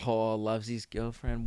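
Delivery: low, very soft, slow teasing; muttered mockery. Mate teasing a guy after his phone call, muttered mockery. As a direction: tease under the breath, drawn out.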